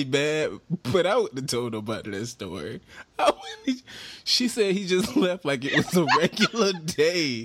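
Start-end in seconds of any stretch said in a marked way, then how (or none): 3.2-7.5 high voice shaken by real laughter; can't stop giggling while telling it; laughing. Real, not acted. Retelling a divorce story, giggling.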